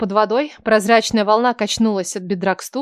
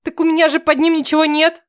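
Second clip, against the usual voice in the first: flustered protest; 6 semitones higher, 5 dB louder than usual. Acted. Героиня смущённо возражает.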